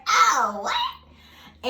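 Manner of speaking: high squeaky shocked what; hammed-up gasp of surprise; mock disbelief. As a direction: act stunned at the news. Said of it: "And I was like".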